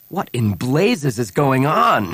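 indignant demand, slow and emphatic. A narrator's reading. Han confronting Bria over the held smugglers, demanding. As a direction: demand an answer, stretching the words out. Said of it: "Han demanded".

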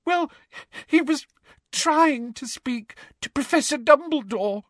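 put-on very high, tearful girl's voice; feigned tearful confession; gulping, halting. A narrator's reading. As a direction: stammer it out in broken bits. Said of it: "gulped Hermione into her hands".

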